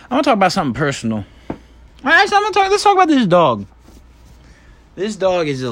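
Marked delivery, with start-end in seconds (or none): 2.0-3.7 sudden high voice. Acted. Sudden high squeaky switch.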